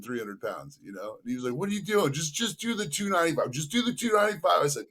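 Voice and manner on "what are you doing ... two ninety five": much higher startled objection; stopping a reckless lift; high-pitched what-are-you-doing; alarmed protest. Retold or imitated. Trainer alarmed at extra weight.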